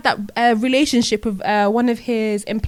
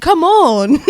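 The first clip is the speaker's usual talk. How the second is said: loud, high, incredulous cry with a laugh in it; exasperated appeal; 6 semitones higher, 8 dB louder than usual. Real, not acted. Speaker protesting a clown running the country, loudly exasperated. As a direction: plead in loud disbelief.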